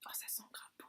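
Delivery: whispered delight; whispering. Real, not acted. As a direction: breathe it, unvoiced. Sniffing the bar.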